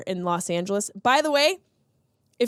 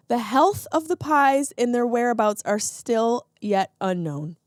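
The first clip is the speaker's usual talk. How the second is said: ordinary talk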